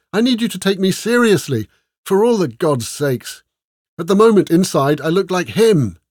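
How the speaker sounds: high, loud, frustrated appeal; emphatic, fed-up demand; exasperated insistence. A narrator's reading. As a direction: plead to be taken seriously, temper fraying. Exasperated demand to be taken seriously.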